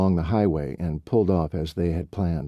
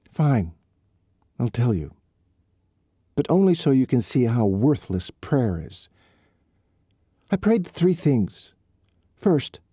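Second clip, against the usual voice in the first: bitter, worked-up rant; 6 semitones higher than usual. Acted. Riley's bitter rant about prayer.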